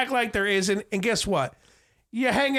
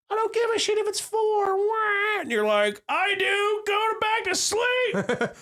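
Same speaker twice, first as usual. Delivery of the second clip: put-on high squeaky baby voice, slow at the end; 13 semitones higher, slower than usual. Dad voicing his baby waking him at four, high and squeaky. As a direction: voice the fussy baby high and whiny. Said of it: "baby's voice imagined".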